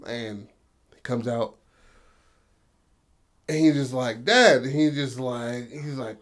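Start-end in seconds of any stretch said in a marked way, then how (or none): 4.2-4.6 excited. Retold or imitated. Son excited to see dad.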